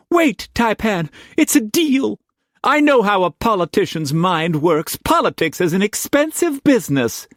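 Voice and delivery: put-on high, insistent young woman; young woman holding Struan back, insistent; young woman urging. A narrator's reading. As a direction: hold him back with urgency, high.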